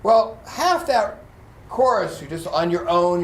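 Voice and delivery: usual speaking voice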